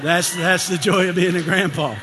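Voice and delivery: real happy shout; joyful shout. Real, not acted. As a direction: shout the joy out to the room. Joyful outburst about grandkids.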